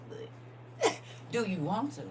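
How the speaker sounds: slow, laughing through tears; half laugh, half cry on the question; emotional. Real, not acted. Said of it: "I'm getting a little emotional".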